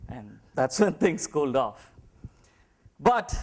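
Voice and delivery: ordinary talk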